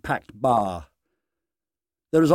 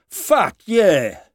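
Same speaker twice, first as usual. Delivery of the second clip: excited cheer; 7 semitones higher, 6 dB louder than usual. A narrator's reading. Pub crowd cheers.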